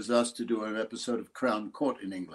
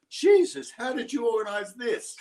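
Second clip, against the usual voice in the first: much higher, louder shocked cry into a laugh; astonished; 12 semitones higher, 4 dB louder than usual. Retold or imitated. Producer stunned to see his episode on TV, high astonished cry. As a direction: gasp it out, high and amazed, laughing. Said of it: "And she goes".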